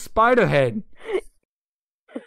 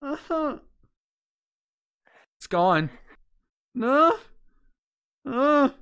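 high startled cries; frightened yelps; 7 semitones higher, slower than usual. Real, not acted. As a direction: yelp in fright at each scare. Startled by horror game.

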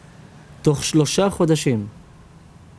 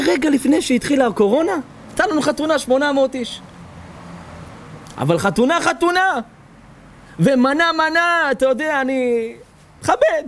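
excited boasting; 11 semitones higher than usual. Retold or imitated. Caller boasts about wedding.

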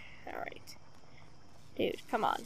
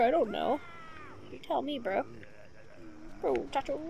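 usual speaking voice

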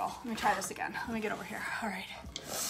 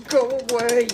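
mock mad; 10 dB louder than usual. Cook telling off snotty porridge, mock mad. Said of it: "You just gotta be mad at it".

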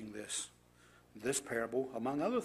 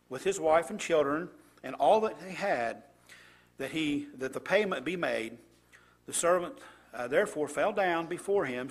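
louder, deliberate Bible reading; scripture reading; 6 dB louder than usual. Acted. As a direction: read the passage out to the congregation with weight. Reads scripture passage aloud.

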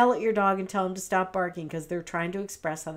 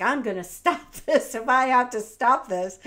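louder, put-on voice of an annoyed dog; 4 semitones higher, 7 dB louder than usual. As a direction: play the dog fed up with the storm.

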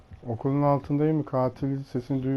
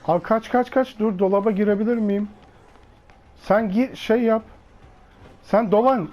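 frantic high urgent calls; panicked, rushing to hide; being chased; 7 semitones higher than usual. Real, not acted.

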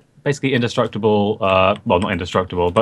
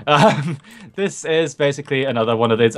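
usual speaking voice

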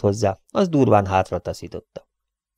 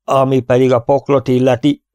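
raised, louder defiant cry; shouting out; 4 semitones higher, 6 dB louder than usual. A narrator's reading. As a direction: cut in with a loud shout. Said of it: "kiáltotta közbe Ellen". (közbekiáltva, hangosan)

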